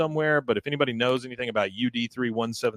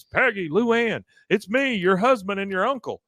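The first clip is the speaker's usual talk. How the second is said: calling out to wife; 8 semitones higher than usual. Retold or imitated. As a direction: call to them through the locked gate, stretching the names. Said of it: "He goes".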